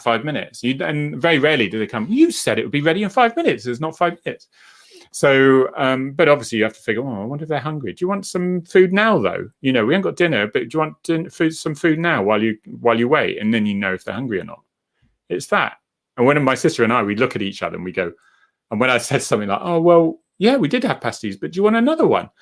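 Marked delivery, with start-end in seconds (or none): 2.1-4.5 higher, lightly voiced indignant mum; mock complaint. Mum complaining dinner is late, a light higher mock grumble. 7.0-13.4 higher, gentle coaxing voice; coaxing carer. Acted. Offering Mum food while she waits, higher gentle coaxing. 19.6-22.3 much higher, cheery humouring voice; bright humouring. Acted. Offering Mum another pasty, higher cheery humouring.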